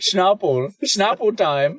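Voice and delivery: high mock Austrian accent; playful fake Austrian voice. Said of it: "your best Austrian accent".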